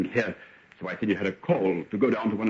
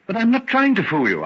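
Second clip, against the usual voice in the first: urgent protest; 10 semitones higher, 6 dB louder than usual. Acted. Henry protests to Hetty.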